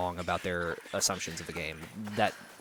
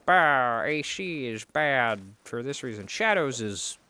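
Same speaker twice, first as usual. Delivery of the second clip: a hint of a scoffing critic, voice a little put-on; lightly mock a complaining YouTuber; slower than usual. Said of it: "you know".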